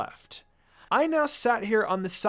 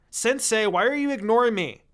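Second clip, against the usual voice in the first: loud, higher yell at the teacher; 5 semitones higher, 5 dB louder than usual. A narrator's reading. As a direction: call him out loudly. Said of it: "I yelled".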